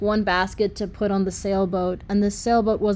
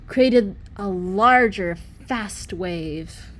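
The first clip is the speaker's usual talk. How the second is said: plain reading voice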